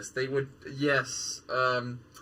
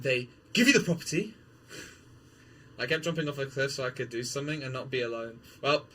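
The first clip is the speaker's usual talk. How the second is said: ordinary talk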